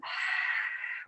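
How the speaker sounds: long breathy sigh out; releasing yoga exhale; audible exhale. Shown as a demonstration. Exhaling aloud after exhaling down.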